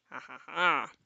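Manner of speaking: soft low chuckle; laughing. Real, not acted. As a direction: laugh quietly to yourself. Reader reacts to scene.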